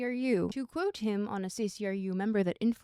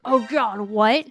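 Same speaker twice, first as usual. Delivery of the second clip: loud startled Oh God, what, rising at the end; shocked realization; 2 semitones lower, 10 dB louder, slower than usual. Retold or imitated. Reader piecing the book together, loud startled gasp. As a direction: blurt it out as the pieces click. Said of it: "you're like".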